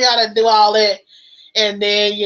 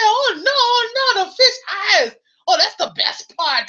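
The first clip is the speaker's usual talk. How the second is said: mock delight; 10 semitones higher than usual. Husband delights in fish eyes.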